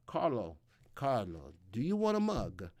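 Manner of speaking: hammed-up Italian accent, lilting up and down. Host offers viewer Carlo a mug, mock Italian accent. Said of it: "It's my Italian accent".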